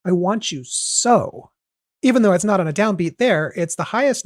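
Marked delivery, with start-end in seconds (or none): 0.0-1.5 slow, lingering stress on "want"; emphasized lyric. Shown as a demonstration. Reading the lyric back with weight on "want". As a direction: lean into "want" and let it hang. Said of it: "emphasizing the way you said it".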